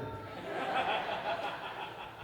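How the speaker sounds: breathy, high burst of real laughter. Real, not acted. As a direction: break into laughter at the memory. Laughs at his own story.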